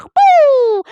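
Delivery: loud high whoosh like a rocket. Acted. Grandma boasting she is a rocket, whooshing off loudly. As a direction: shoot off with a loud high burst.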